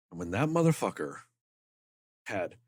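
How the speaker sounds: ordinary talk, nothing marked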